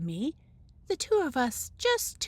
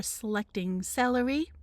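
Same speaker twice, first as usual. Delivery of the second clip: usual speaking voice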